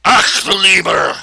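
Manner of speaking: put-on loud, high German-accented outburst of a mad scientist; hammed-up German accent exclamation. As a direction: bark it like a cartoon German scientist. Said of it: "sound like, you know, German mad scientists".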